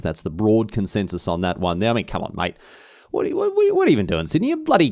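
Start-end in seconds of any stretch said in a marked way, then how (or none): 3.1-4.9 incredulous jab at Sydney, pitch jumping up; cheeky mock outrage; mock exasperation. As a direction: playfully scold the rival city.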